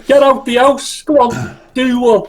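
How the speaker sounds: mock Liverpool "get out the house"; old Scouse fella chasing someone out, Scouse accent. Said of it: "the old Scouse".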